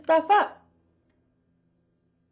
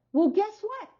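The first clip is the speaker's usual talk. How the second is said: ordinary delivery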